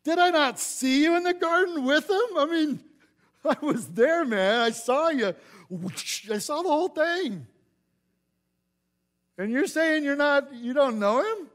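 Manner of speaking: put-on shrill-free high falsetto of the servant girl; accusing servant girl. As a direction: corner him like a nosy servant who saw it all.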